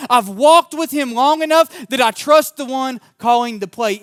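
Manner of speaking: loud, high, driving sermon voice, slowing on Jesus; preaching at full force. Acted. Pastor urging the congregation to trust God, loud preaching. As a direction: preach it out like a stadium coach calling the play.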